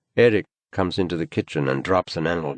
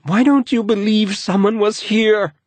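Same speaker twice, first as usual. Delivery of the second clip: angry; 8 dB louder than usual. A narrator's reading. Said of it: "she asks angrily".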